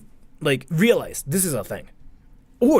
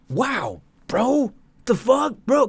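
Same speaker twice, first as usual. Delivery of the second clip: higher, slow, drawn-out "bro"; slow shocked exclamation; 8 semitones higher, slower than usual. Acted. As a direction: draw it out in amazement. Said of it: "saying, like".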